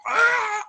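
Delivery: high, loud wordless holler; imitated yell. Two loud guys yelling back and forth, high mock yell. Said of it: "yelling to him".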